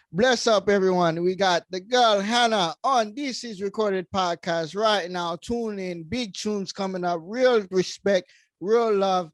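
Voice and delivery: put-on loud, high Jamaican DJ accent. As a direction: hype the crowd in Jamaican patois. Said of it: "I'm gonna do my own Jamaican accent".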